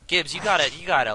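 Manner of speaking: loud urging; urgent call. Real, not acted. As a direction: urge a friend loudly. Urging friend.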